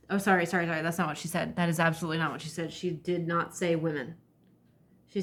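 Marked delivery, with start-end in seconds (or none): none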